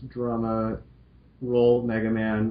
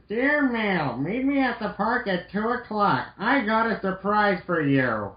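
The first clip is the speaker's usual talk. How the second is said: put-on higher, bright girl-robot voice; cheerful Roll voice; 10 semitones higher than usual. Roll greeting Mal cheerfully in a higher voice. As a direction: play sweet Roll greeting her friend.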